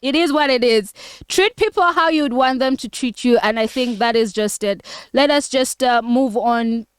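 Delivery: ordinary talk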